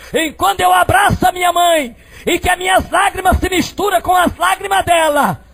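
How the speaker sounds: high, driving preacher's shout; full-force preaching. Acted. Preacher telling of embracing his praying mother, high shouted preaching. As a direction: push each phrase out high and loud.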